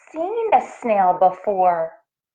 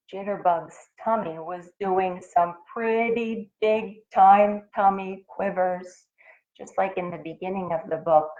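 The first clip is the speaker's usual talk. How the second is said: plain reading voice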